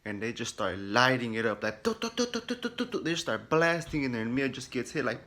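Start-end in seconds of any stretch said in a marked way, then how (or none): 1.8-3.0 high rapid-fire "tu tu" gun noises; mock gunfire sound effect; imitated gunfire. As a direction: mouth the machine-gun burst in a high voice.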